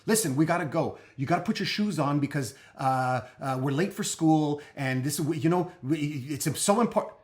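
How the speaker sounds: put-on the frazzled parent rushing a kid out the door; hammed-up pushy parent nagging loudly; stressed parent. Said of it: "So if you're like".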